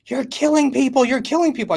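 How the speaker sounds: high, loud mock scream of an angry passenger; retold screaming. Passenger screaming at the speaker's son, imitated high, loud shriek. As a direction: shriek it like she's losing it on the plane. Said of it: "She gets up screaming".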